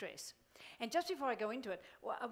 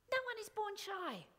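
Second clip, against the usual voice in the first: ordinary delivery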